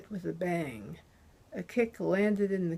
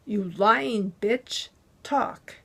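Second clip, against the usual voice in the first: furious shout; 2 semitones higher, 6 dB louder, slower than usual. A narrator's reading. Thug screaming at the bound captive, enraged yell. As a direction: roar the insult at her.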